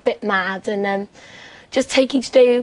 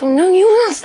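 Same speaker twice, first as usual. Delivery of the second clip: higher, louder playful refusal; retold scene with the girls dodging asking, in a playful higher protest; mock protest; 9 semitones higher, 7 dB louder than usual. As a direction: push the job back with a laughing no. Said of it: "we were like".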